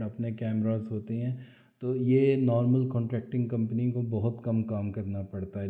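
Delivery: normal talking voice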